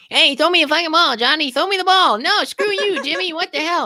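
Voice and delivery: very high, loud mock kid yelling; twelve-year-old gamers. Mocking preteens in an online football game, high shrill yelling. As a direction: squabble like kids yelling for the ball. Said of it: "it's just a bunch of twelve-year-olds".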